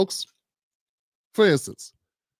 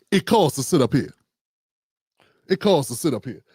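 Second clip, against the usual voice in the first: lower, swaggering mob boss tone; imitating gangster pastor; 7 semitones lower than usual. Commentator imitating pastor demanding a hundred dollars, gangster swagger. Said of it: "his exact words was".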